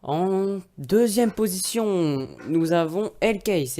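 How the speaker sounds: slow, dramatic countdown reveal; dramatic announcer. Acted. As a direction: announce it slowly like a show host.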